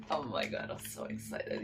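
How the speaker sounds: breathless excited whisper; whispered excitement. Real, not acted. Whispering her excitement to viewers before the reveal. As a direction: whisper it, barely containing yourself.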